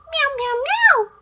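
squeaky high meow, meow, meow; high cat meow. Pretend. Grandma meowing like a cat for the child. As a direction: play the kitten, up high.

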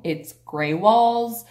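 ordinary talk, nothing marked

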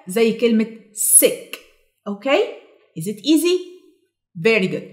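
normal talking voice

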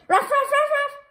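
high loud yappy barks; dog barking. Acted. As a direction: bark like an excited dog. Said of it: "Then Dogman goes".

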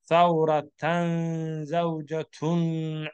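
Thawratan zawjatun linked slowly, every vowel full; connected reading. Shown as a demonstration. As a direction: read Thawratan zawjatun slowly as one phrase. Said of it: "Or to continue".

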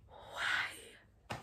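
breathy, shocked whispered why. Retold or imitated. Mocking her own odd sure from the vlog, a whispered why. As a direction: breathe the word out like a gasp. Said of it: "my sure there was just like".

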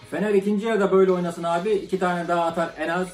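ordinary talk

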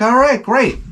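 high, fake cheerful reply; mock enthusiasm. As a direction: fake being delighted. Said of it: "I'm like".

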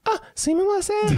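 very high, loud falsetto apology; squeaky falsetto. Pretend. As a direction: squeal it like a cartoon. Mock phone call, high squeak.

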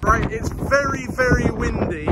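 ordinary talk

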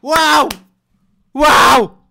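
high, loud wow screams; excited screams. Real, not acted. Vlogger screaming as the track ends, thrilled. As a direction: blown away, shout it out. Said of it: "Apologize for screaming".

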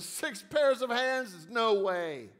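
slowed-down, high, incredulous question; slow disbelief. A narrator's reading. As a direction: repeat the number as if it can't be true. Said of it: "The angel shook her head slowly and said".

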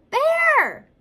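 very high, loud shout; crying out. A narrator's reading. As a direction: shout the name to wake a sleeper. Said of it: "cried Ladybug".

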